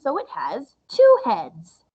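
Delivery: ordinary talk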